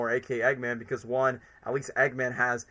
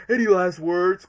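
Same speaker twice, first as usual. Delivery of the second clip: Ivo quote; 4 semitones higher, 7 dB louder than usual. Retold or imitated. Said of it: "just like Ivo said it".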